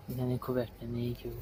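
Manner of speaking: normal talking voice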